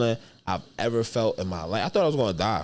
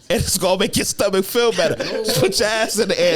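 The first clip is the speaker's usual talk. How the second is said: high, louder, laughing through the words; 10 semitones higher, 5 dB louder than usual. Retold or imitated. Retelling advice to himself while sick, laughing high. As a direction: crack up retelling the dumb advice.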